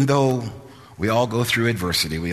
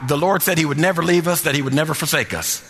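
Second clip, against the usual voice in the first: assured preacher voicing faith, slightly raised pitch; confident declaration; 6 semitones higher than usual. Preacher voicing what Israel should have said, confident. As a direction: declare it without a doubt, steadily. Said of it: "we say it with confidence".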